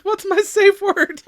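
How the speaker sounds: pleading, over-the-top high voice; high, slow, loud mock voice. Repeated, slower and louder.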